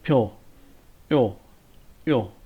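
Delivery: "यो" repeated slowly as an isolated syllable; sound drill. Shown as a demonstration. Teacher drilling the yo sound for students, slow syllables. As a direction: sound out "फ्यो" then "यो", each alone. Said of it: "इस तरह से sound बनेगा".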